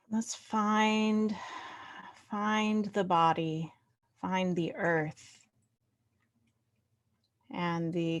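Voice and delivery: unhurried meditation voice with long pauses; guided meditation. Real, not acted. Leader inviting the group to find body and earth, guided meditation. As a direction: settle them gently into body and breath.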